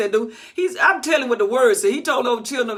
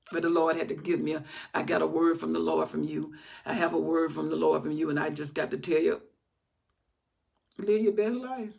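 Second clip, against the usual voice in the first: hushed, lower voice passing on a word; hushed prophecy; 6 semitones lower, 8 dB quieter than usual. Retold or imitated. Preacher voicing a hushed prophet to the congregation, softly. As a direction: lean in and pass the word along softly.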